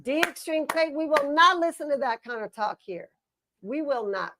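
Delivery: mock stern scolding. Scolding her co-host in mock sternness for knocking the tag team. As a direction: forbid that talk like a mock headmistress.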